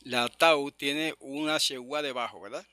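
ordinary talk

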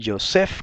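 Joseph stressed on the last syllable. Shown as a demonstration. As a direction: say jo-SEPH, accent on the E of Joseph. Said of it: "con acento en la E".

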